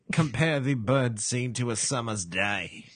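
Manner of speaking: slow mock-Shakespearean British recital; posh British accent. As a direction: recite like a posh English parrot. Joins in the posh parrot act.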